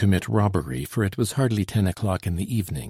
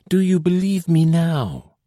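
high, louder, anxious voice; anxious plea; 8 semitones higher, 5 dB louder than usual. A narrator's reading. Frightened girl pleading with the soldiers, high and anxious. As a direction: beg to be believed. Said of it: "in tones of deep anxiety".

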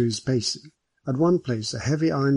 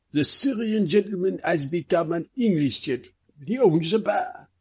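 joking detective; 6 semitones higher than usual. Acted. Detective joking to his waiting companions, playful character voice. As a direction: deliver the detective's joke in a raised character voice.

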